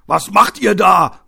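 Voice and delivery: loud, high, slow shout; called-out challenge. Acted. A bystander interrupts attackers in the alley, shouted challenge. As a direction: shout it out to stop them. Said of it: "rief eine Stimme".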